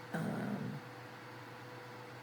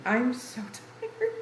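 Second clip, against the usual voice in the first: tired laugh; 7 semitones higher, slower than usual. Real, not acted. Laughs about own tiredness.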